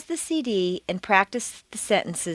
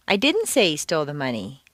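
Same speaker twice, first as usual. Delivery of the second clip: "didn't" raised and emphasized; stress on DIDN'T; 4 semitones lower than usual. Shown as a demonstration. Teacher denying having said it, stress on "didn't". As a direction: punch "didn't" in firm denial. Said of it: "That's not true at all".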